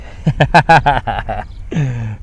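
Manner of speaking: real burst of amused laughter; hearty laugh. Real, not acted. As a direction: laugh freely at your own joke. Laughs at own West Country joke.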